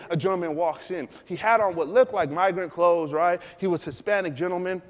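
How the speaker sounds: normal talking voice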